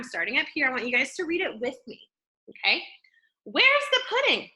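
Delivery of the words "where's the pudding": high questioning mother voice; mother asking. Shown as a demonstration. Mother calling for the pudding, high asking voice. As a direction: ask like a worried mom. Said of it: "my mother said".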